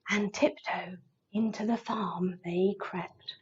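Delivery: sneaky storytelling whisper; very soft, secretive whisper; hushed tiptoe whisper. A narrator's reading. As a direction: whisper it as if creeping past a sleeper. Tiptoeing burglars in a read-aloud story.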